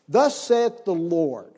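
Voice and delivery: very slow, weighty intoning; solemn reading. Shown as a demonstration. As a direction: draw out each word with reverence. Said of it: "This is an incredible verse".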